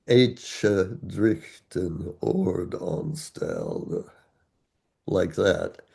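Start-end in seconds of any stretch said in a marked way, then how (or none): 0.1-4.1 put-on slow, soft Old English recital; measured Beowulf reading, softer and very slow; deliberate sober recitation. Shown as a demonstration. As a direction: recite it slow and sober, weighing each beat. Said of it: "Nice and deliberate and sober".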